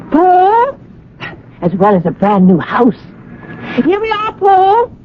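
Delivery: high bright call to Paul. Acted. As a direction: call across to him cheerfully. Calling to husband at car.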